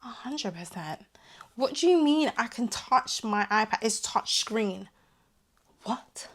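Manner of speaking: usual speaking voice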